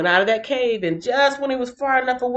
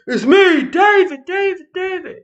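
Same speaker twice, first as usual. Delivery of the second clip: laughing; 9 semitones higher, 3 dB louder than usual. Retold or imitated. David reveals himself, laughing.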